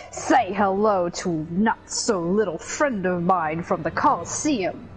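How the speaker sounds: slow, gloating villain taunt; slow theatrical villain menace. Acted. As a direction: ham up the cartoon villain. Reading villain lines aloud.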